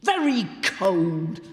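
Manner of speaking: a regal goblin drawing out the cold; Goblin King. A narrator's reading. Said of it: "said the king".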